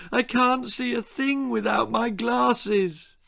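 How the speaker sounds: high, slow tearful begging; a bullied boy pleading for his glasses, tearful; tearful plea. Acted. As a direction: beg through the tears. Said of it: "Timothy was crying".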